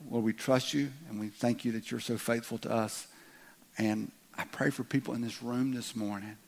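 soft, measured prayer voice; earnest prayer. Real, not acted. Pastor praying softly over the congregation, earnest. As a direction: pray it quietly and sincerely.

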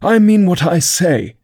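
high, slow indignant reply; offended. A narrator's reading. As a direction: insist with wounded dignity. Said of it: "replied in an offended tone".

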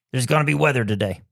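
normal talking voice

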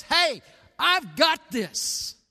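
loud, high, slow declaration; emphatic assurance. Acted. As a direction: proclaim it firmly, stretching each word. Voicing Jesus reassuring the church.

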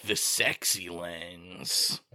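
very low, drawn-out self-parody; mock lustful dope. Host parodying himself and Mike as shallow reviewers, mock lustful. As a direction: drag it out low and slow, hammed-up. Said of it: "are the ones that are just like".

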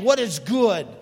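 ordinary talk, nothing marked

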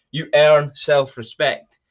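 normal talking voice

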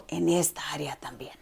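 soft whisper of God's words; whispered quote. Retold or imitated. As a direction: breathe it like a gentle promise.

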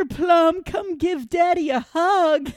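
high, loud, drawn-out doting dad; retold yelling. Dad hollering to his child outside school, mock doting yell. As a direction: ham up a sky-high embarrassing holler. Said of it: "just yelling".

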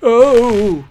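high, loud "oh" of alarm; dismayed cry. A narrator's reading. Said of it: "Prestgar cried".

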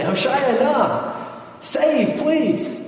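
mock plea, pitched high and drawn out; acted cry for help. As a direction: plead like someone drowning, without shouting. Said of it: "would have shouted if".

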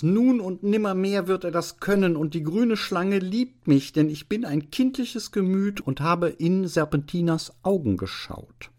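high, fervent student's protest; impassioned cry. Acted. Student Anselmus professing the snake's love, crying out fervently. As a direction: declare it rapturously, pitched high. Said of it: "schrie der Student Anselmus".

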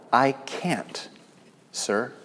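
softly conceding defeat; hushed, humble I can't, sir; a small voice giving up; quiet admission. Acted. Lame man's humble reply.

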